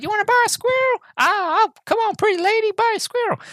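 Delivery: very high, screechy cartoon pedlar; crazy squirrel lady. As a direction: pester them in a crazed squeal. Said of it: "crazy squirrel lady".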